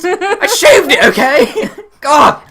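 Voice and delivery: loud, high, half-laughing indignation; mock defensive outburst. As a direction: snap back in hammed-up exasperation, laughing. Mock outburst when teased about beard.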